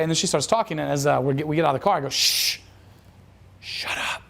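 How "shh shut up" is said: whispered shushing order; firm hushed command; hushed authority. Retold or imitated. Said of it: "taking a bit of an authority tone".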